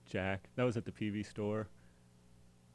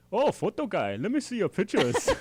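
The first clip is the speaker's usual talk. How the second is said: put-on high, loud German-accented exclamation; Rudolph's German accent; 11 semitones higher, 8 dB louder than usual. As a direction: call it out big in a German accent. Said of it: "It was in his German accent".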